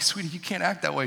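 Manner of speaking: ordinary talk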